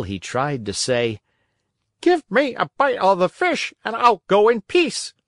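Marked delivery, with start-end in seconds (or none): 2.0-5.1 high-pitched, pleading dog voice; whining dog. A narrator's reading. Hungry dog begging the fisherman for fish, whining. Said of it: "whining and wagging his tail".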